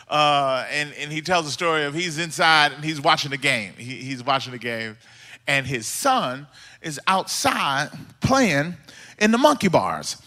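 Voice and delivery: ordinary talk, nothing marked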